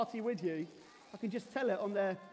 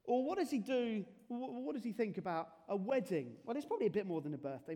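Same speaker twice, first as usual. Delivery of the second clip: ordinary talk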